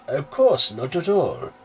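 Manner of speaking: mother accepting the priest's brownies, high and eager; eager hostess. Acted. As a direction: gush it like a delighted host. Missus Danes welcomes the brownies.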